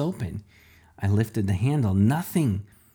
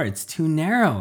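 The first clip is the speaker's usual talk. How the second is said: whined; 6 semitones higher than usual. A narrator's reading. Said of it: "I whined".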